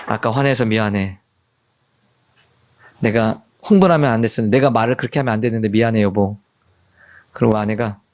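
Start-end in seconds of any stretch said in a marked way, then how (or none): 0.1-6.4 low, subdued apology; gentle apology. Retold or imitated. Husband apologizing to wife after an argument, gentle and low.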